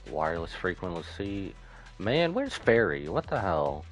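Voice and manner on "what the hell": ordinary delivery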